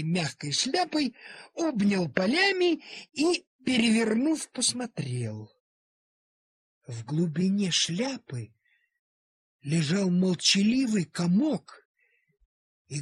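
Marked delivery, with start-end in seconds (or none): none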